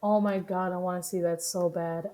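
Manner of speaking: ordinary talk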